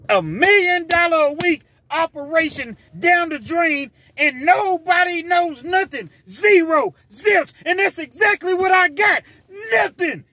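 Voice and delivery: furious shout. Retold or imitated. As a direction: yell it high and loud like a furious drug boss. Villain yelling at his crew.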